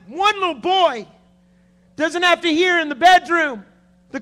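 high, loud cry from the pulpit; impassioned preaching shout. Real, not acted. Preacher pleading for one little boy's family, impassioned high shout. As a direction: hammer each phrase out with long pauses between.

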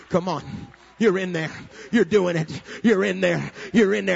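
loud, punchy encouragement; urgent cheering. Acted. God at bedside cheers on believer, urging loudly. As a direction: cheer them on in short bursts.

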